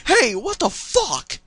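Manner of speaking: high, loud, drawn-out shocked cry; hammed-up shock, stretching the words. A narrator's reading. Dash spots the thief in the orchard, shocked outcry. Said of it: "points a hoof towards the orchard in shock".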